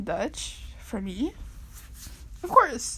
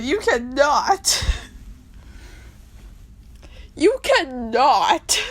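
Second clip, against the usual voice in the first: slow, drawn-out, louder You cannot; mock outrage; 5 semitones higher, 5 dB louder, much slower than usual. Fan scolding Hamilton for a sad song, mock outrage. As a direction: stretch it out, scolding in mock horror.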